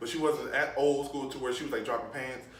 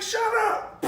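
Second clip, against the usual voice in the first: mom yelling; 6 dB louder than usual. Retold or imitated. Mom yelling at crying kid.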